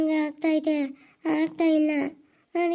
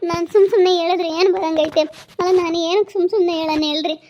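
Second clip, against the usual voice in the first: loud, hurt protest at being doubted; indignant protest; 7 dB louder, faster than usual. Acted. Wife insists to her sleepy husband, loud and indignant. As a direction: push back hard, she's not lying.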